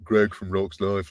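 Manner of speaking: slow, deep mock Greg accent; lightly imitating a deep English voice. American host mimicking the guest's deep accent. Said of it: "you're very deep".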